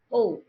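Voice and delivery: O as one pure rounded vowel; O held as a single round sound; mother-tongue O. Shown as a demonstration. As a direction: say O without any glide. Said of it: "that is not the pronunciation of O".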